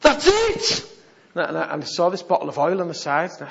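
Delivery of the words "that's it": high, loud burst of resolve; sudden decision. Retold or imitated. Preacher deciding in the bath, a high excited burst. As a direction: blurt it out. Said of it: "I thought".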